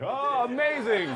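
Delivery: sarcastic thrilled exclamation; mock amazement. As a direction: gush like a producer loving a dumb pitch. Mock pitch enthusiasm.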